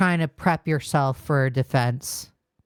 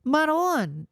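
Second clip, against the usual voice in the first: ordinary talk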